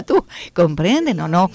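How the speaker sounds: normal talking voice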